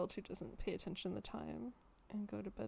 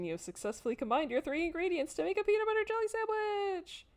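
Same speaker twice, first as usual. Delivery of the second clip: mock triumphant; 9 semitones higher, 6 dB louder than usual.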